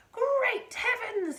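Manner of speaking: high, loud, slow drawn-out exclamation; excited cry. A narrator's reading. Mister Cranky crying out at the giant hen. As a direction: cry out in amazement. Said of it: "he cried".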